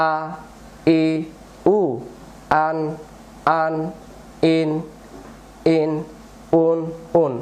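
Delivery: one syllable at a time, long pauses between; broken-up syllable drill. Shown as a demonstration. Teacher breaking the line up for new learners, syllable by syllable. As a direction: sound out each syllable separately, leaving gaps. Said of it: "ভেঙে ভেঙে পড়বো".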